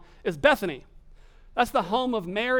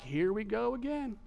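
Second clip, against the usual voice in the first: softer, understated here-we-go reaction; disciples' reaction; 5 dB quieter than usual. Acted. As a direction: say it under your breath, expecting a repeat. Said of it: "the disciples are like".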